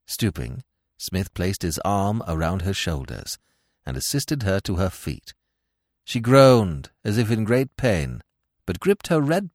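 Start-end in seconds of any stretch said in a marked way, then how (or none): none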